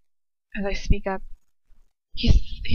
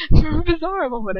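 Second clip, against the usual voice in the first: laughing; 8 semitones higher, 6 dB louder than usual. Real, not acted. Rereading while laughing.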